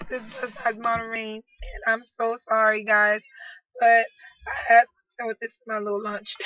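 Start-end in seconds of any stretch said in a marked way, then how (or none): none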